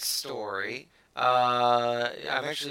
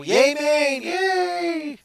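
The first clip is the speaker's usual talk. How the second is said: loud, high, drawn-out cheer; mock cheer; 10 dB louder, much slower than usual. Host cheering the Maine marriage news, mock celebration. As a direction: let the yay soar way up high.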